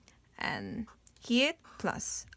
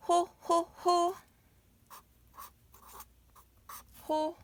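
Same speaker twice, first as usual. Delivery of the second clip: high dainty ho-ho-ho; ladylike giggle; 8 semitones higher, much faster than usual. Shown as a demonstration. Teacher showing how mom laughs, ladylike giggle. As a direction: giggle like a lady. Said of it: "Lady sound".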